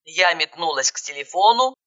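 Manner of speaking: ordinary delivery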